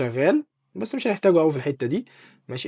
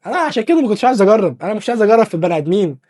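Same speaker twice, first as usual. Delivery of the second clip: higher, louder, fast protest; flustered, louder insistence he didn't want to; excited protest; 5 semitones higher, 5 dB louder, faster than usual. Real, not acted. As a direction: blurt it out half-laughing. Protesting after bad result.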